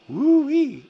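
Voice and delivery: loud, high-pitched "woo-wee"; high whooped woo-wee. Retold or imitated. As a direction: whoop it out like you just took a big hit. Reacting to being punched hard.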